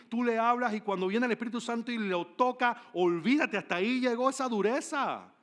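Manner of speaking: high, forceful pulpit delivery; impassioned preaching. Real, not acted. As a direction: build to a loud, emphatic preaching climax.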